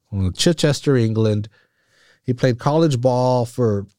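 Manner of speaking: ordinary talk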